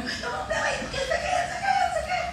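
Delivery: high, laughing mock shout; retold yelling. Woman mimicking her own outburst at the pastor, mock shout. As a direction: shriek the protest high, half laughing. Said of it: "eu gritava".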